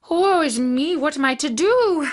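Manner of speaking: a hammed-up wail of despair; woe is me. Monkey despairs at his fate.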